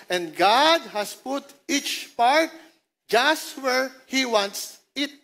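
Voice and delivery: slow, deliberate reading of the verse; slow, weighted reading; slow scripture reading. Acted. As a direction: lay out each word slowly.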